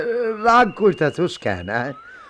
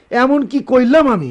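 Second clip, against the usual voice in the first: raised, defensive protest; indignant; 7 semitones higher, 5 dB louder than usual. Acted. Father answering his accusing son, raised and defensive. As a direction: push back hard at the accusation.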